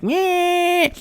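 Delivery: very high, squeaky dismissive noise; mocking the haters. Imitating haters doubting the prophecy, high mocking squeak. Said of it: "haters would be like".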